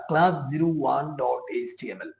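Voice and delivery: each word spelled out slowly; careful, deliberate dictation. Shown as a demonstration. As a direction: read the filename out slowly for beginners. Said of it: "কথাগুলো একটু আস্তে আস্তে বলতেছি".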